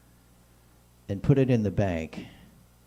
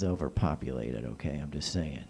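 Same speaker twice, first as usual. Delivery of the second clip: quiet aside; 8 semitones lower, 8 dB quieter than usual. Real, not acted. Sharp drop to quiet aside.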